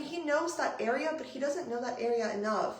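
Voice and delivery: normal talking voice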